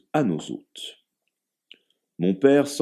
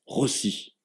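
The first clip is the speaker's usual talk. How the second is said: breathless slip; 5 semitones higher, 4 dB quieter than usual. A narrator's reading. Name slips out involuntarily.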